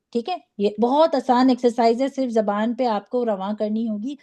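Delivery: ordinary talk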